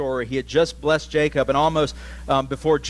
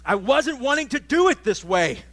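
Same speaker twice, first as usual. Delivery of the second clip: a higher, pleading Isaac praying to God; anguished prayer; 10 semitones higher than usual. Retold or imitated. Isaac confessing to God he blessed the wrong son, higher voice. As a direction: plead with God, a touch distraught. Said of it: "where it's like".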